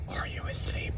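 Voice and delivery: mocking the whispered question; breathy hush, no voice at all. Comedian mocking the sister-waking question, whispered. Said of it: "somebody come in the room and goes".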